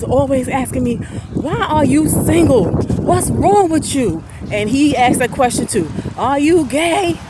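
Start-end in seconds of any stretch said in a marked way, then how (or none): 1.3-4.2 pushy, drawn-out questioning friend; nosy friend voice. Retold or imitated. 6.2-7.2 slow, higher prying question; blunt friend voice. Retold or imitated.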